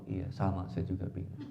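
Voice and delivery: low, mild husband reply; acted calm concession; meek agreement. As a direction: agree placidly, dropping the pitch. Acted husband mildly agreeing with her.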